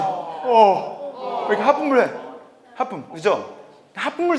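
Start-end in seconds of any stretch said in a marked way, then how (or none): 0.0-1.5 high, open "어" like a yawn; long wide-throated "어"; yawning vowel. Shown as a demonstration. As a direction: yawn through "어". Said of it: "하품하듯이".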